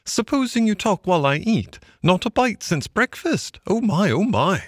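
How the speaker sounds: high-pitched little Mole voice; hungry Mole. A narrator's reading. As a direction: chatter high, eager for supper.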